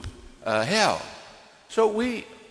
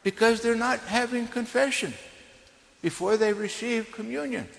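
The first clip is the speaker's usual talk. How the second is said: higher-pitched imitation of scandalized churchgoers; mock scandalized; 7 semitones higher than usual. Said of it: "they might be scandalized".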